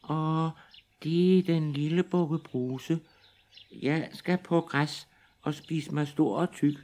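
high, thin little goat; fine little goat voice. Acted. Little goat answers the troll in a high thin voice. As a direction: squeak it out like the tiniest goat. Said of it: "Den var så fin i mælet".

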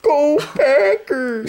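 slow, high Minnesotan cheer with a laugh. Pretend. Cheering for the Packers as a goofy Minnesota fan. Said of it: "more like Minnesotan accent".

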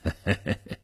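quiet low chuckle; soft, low, knowing laugh. Acted. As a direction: chuckle under the breath. Old gangster amused by youngster.

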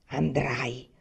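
low, hushed threat; menacing whisper. A narrator's reading. Father forces his son to go, whispering menacingly. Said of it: "sussurrò minaccioso suo padre".

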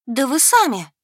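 loud, high outraged cry; indignant exclamation. Acted. Heroine bursts out at the dragon, loud and indignant. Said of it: "воскликнула, задохнувшись возмущением".

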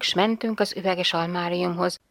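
ordinary delivery, nothing marked

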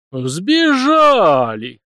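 loud, slow, drawn-out reproach; reproachful exclamation. A narrator's reading. Priest reproaching the runaway girl: loud, slow and reproachful. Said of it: "укоризненно воскликнул он".

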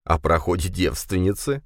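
usual speaking voice

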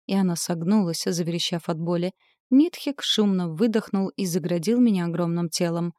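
ordinary delivery, nothing marked

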